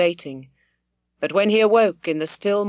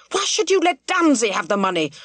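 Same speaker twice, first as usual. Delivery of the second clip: angry demand; 4 semitones higher than usual. A narrator's reading. Squire demanding why his son gave Dunsey money, angry shout. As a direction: press him for the reason, furious. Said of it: "The squire was purple with anger".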